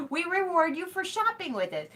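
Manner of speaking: sing-song mocking of the store's promise; mock sweet ad voice.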